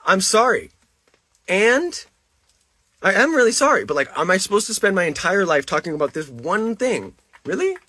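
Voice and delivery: high, loud, frustrated pleading; exasperated outburst. Real, not acted. Pleading with chat, fed up with the apology demands, loud. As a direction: push the apology out in rising frustration.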